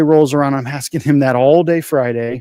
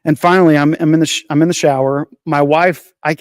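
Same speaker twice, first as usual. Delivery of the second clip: ordinary delivery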